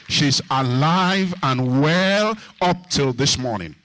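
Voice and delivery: loud, drawn-out proclamation; slow, booming pulpit declaration; triumphant preaching. Real, not acted. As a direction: stretch it out and build in volume. Preaches at full force.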